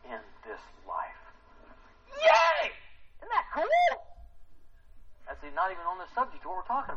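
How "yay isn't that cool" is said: squealing high cheer of delight; excited. Real, not acted. Preacher thrilled at the Bible detail. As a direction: burst out with thrilled glee. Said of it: "I get excited about stuff".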